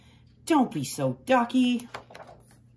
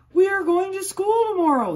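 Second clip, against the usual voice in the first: high, loud excited announcement; excited farmer announcing; 12 semitones higher, 8 dB louder than usual. A narrator's reading. Farmer telling the cows, high and loud with excitement. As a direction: call it out high and bright with excitement. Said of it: "he announced".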